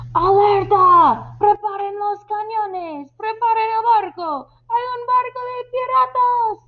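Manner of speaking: high, urgent cry to the crew; alarm shout. Acted. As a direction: raise the alarm across the deck. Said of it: "les grita a los hombres". (grito de alarma a los hombres)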